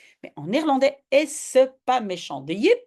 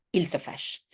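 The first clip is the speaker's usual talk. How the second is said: quiet aside; 4 semitones lower, 6 dB quieter than usual. Real, not acted. Aside after translation.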